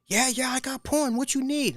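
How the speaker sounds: high mock African accent; friend eager. Friend offering porn, in a high, eager mock accent. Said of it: "he was like".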